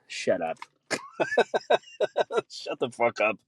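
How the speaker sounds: usual speaking voice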